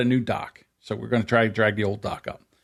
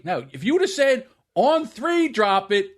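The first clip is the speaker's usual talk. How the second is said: heated argument; 9 semitones higher than usual. Retold or imitated. Argues his point to dad.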